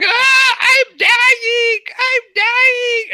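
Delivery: high, loud dying shriek; Wicked Witch of the West. Acted. Game master voices the stabbed witch screaming, high theatrical shriek. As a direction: scream it out in hammed-up agony. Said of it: "she's screaming".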